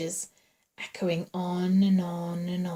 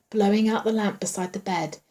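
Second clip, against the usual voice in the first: usual speaking voice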